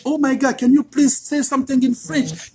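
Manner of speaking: mock-gushing plea; delighted request. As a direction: ask like a delighted admirer. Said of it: "Ils sont contents".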